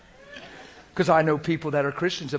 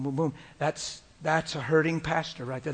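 ordinary talk, nothing marked

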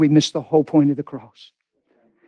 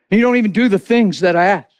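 ordinary talk

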